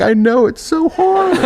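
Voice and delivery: very high, slow comic wail; mock crying. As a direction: wail along in hammed-up self-pity. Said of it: "they're crying".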